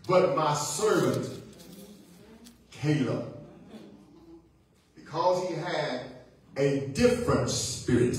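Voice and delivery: slow, measured scripture reading; real slow reading. Real, not acted. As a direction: read carefully, pausing between phrases. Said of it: "I'm gonna read it real slow".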